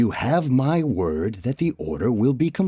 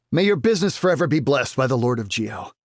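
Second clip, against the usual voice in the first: rushed blessing; 2 semitones higher, much faster than usual. Acted. Merchant's effusive blessing, rushed.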